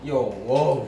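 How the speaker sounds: gasping after spicy bite; eating chili. Real, not acted. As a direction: mouth burning from chili.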